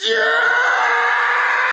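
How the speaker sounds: high, loud yell; excited shout. Retold or imitated. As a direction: blurt it out at full voice. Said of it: "What I actually said was".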